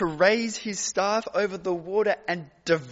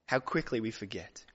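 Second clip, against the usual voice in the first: much lower and softer; hushed refrain; 8 semitones lower, 9 dB quieter than usual. Acted. Preacher to the congregation, hushed and sorrowful. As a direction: drop to a hushed, lamenting close.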